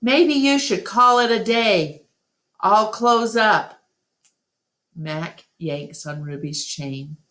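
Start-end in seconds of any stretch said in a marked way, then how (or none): 0.0-3.8 higher, louder call across the room; yelled name. A narrator's reading. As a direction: call out across the food court. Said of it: "he yells".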